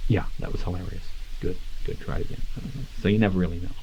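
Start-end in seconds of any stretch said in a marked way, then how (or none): 0.1-2.4 mumbled, half-whispered director feedback; flat bored director. Retold or imitated. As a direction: toss it off like a jaded director. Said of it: "you just get the guy going".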